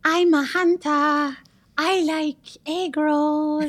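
very slow, high, drawn-out singer's impression. Guest does an impression on request, high and drawn out. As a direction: stretch each word out high in a comic imitation.

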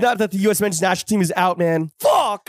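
high frustrated shout; despairing shout. Real, not acted. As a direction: yell it out in sports despair. Team knocked out of World Cup.

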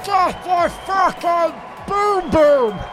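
high, slow, drawn-out comic wail; hammed-up desperate pleading, stretching every word; panicked cry for help. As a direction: wail as if winded and dying. Acts himself winded, begging for help.

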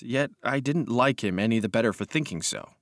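ordinary delivery

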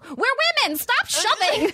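high-pitched retold scolding cry; mock chiding. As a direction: imitate a mom telling off grown men. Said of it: "I'm chiding, like, grown men".

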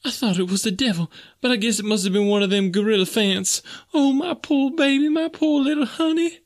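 high falsetto maid, climbing into a lament; wailing near sobs. A narrator's reading. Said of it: "again Esmeralda broke into uncontrollable sobbing".

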